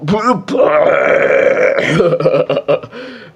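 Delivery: bursting into high loud laughter; loud laughing fit. Real, not acted. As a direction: crack up loudly, can't stop. Laughing hard at own joke.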